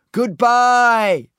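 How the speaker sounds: long, very high farewell shout; crying out. A narrator's reading. Gulliver sailing away from Blefuscu, shouted farewell. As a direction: holler it across the water, stretching the end. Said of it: "he cried".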